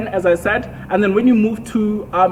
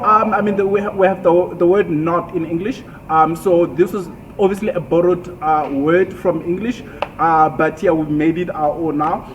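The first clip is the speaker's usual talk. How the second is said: normal talking voice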